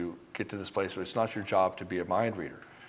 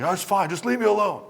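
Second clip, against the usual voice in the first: high, louder mock-annoyed woman's voice; mock huffy woman; 11 semitones higher, 7 dB louder than usual. Man mimics girlfriend snapping she's fine, high and loud. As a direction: play the sulking partner brushing him off.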